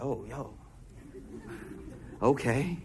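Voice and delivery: low, soft, taken-aback mutter under the breath; awkward mumble. Retold or imitated. Sitting by a crying man, mumbling unsure. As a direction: mumble it like you don't know where to look. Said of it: "You're like".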